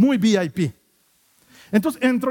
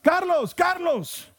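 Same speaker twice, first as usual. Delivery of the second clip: calling out; 9 semitones higher, much slower than usual. Retold or imitated. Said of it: "escucho que alguien grita".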